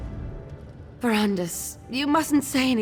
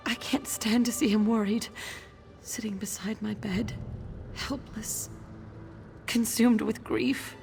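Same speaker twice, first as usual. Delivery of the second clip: sorrowful confession; 4 dB quieter than usual. Acted. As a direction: voice fading softly into sadness. Ailing woman dreads husband's grief.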